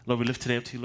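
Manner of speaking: ordinary delivery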